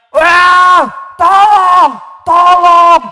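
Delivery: loud desperate high cries for help; screaming for help. Acted. Trapped lion screams for help to no one. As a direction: shout it as a trapped lion begging for rescue. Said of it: "Panjang dia terikan".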